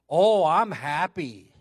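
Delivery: loud, slow retold happy reply; happy declaration. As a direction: stretch it out loud, acting her happiness. Acting friend's happy announcement.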